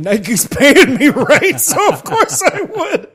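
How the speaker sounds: amused, slowed by real laughter; laughing. Real, not acted. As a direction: laugh through the words. Joking about selling out, laughing.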